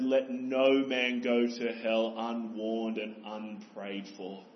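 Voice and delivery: slow, grave, almost intoned quotation; slow solemn Spurgeon quotation; solemn quoted words. Retold or imitated. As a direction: read it like a weighty old saying. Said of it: "Charles Spurgeon said".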